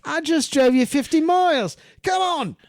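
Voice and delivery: high, loud, indignant protest; outraged shout of a hurt truck driver; upset driver. Retold or imitated. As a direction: voice the insulted driver pleading hard. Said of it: "he's really upset with it".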